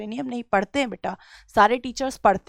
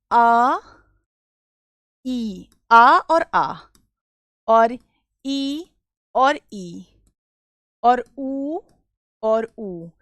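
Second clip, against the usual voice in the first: drawn-out vowels; much slower than usual. Shown as a demonstration. Teacher to class, slowly listing the vowels. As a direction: stretch each vowel slowly. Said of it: "तो अगर हम किन से".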